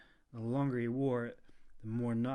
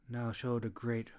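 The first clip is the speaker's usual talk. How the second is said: ordinary delivery, nothing marked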